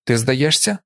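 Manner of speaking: mild narrated call. A narrator's reading. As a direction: call it out with modest force. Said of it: "вигукнув".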